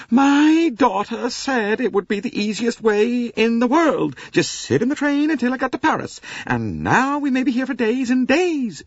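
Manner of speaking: high, loud American wail; wailing Mrs Hubbard. Acted. Mrs Hubbard wails to the passengers about being stranded. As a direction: lament loudly like a fussy American matron. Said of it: "she wailed".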